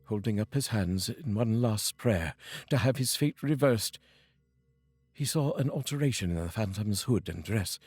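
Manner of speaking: ordinary delivery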